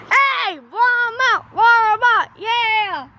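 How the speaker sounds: high, drawn-out call across the road; calling out to a truck. Real, not acted. Kid calls out to the passing Walmart truck, high and stretched. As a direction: hail the Walmart truck to honk.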